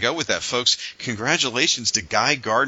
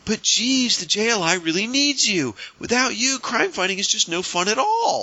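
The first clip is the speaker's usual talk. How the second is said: much higher, whining mock plea; whiny Guy Gardner; 11 semitones higher than usual. Guy Gardner begging Batman to come along, high mock whine. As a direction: whine like an overeager pal.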